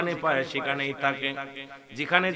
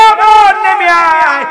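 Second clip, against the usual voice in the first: very loud, slow high call; battle challenge shout; 18 dB louder, much slower than usual. Acted. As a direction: bellow the challenge to come down. Ali calling Amr down to fight.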